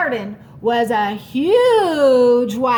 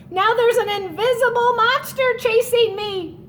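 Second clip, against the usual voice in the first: panicky; 8 semitones higher than usual. Acted. Mouse panicking, chased.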